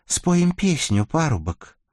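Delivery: high, slow eerie maiden; eerie rusalka voice. A narrator's reading. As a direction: lure him with an eerie drawn-out voice. Rusalka speaks to Vakula.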